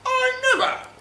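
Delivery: high, loud, scandalised gasp of offense; C-3PO. Acted. C-3PO insulted by R2-D2, high-pitched outrage. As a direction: squeal in hammed-up affront. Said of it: "C three P O was like".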